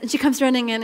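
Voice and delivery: normal talking voice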